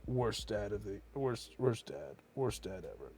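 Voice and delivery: low, very soft muttering; quiet, hammed-up shame; mock self-reproach. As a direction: mumble it to yourself, low. Muttered self-blame after eating brownie.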